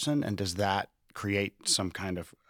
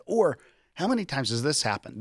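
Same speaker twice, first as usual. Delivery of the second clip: usual speaking voice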